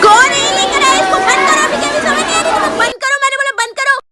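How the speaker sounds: high, loud angry yelling; furious shout. Acted. Teacher yelling at the class to stop, high and loud.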